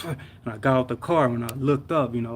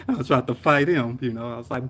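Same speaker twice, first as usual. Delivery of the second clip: ordinary delivery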